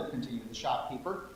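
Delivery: plain reading voice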